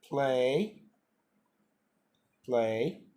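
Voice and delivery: Wort play deutlich vorgesprochen; saying play. Shown as a demonstration. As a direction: play langsam und deutlich vorsagen. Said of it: "الرمز الصوتي".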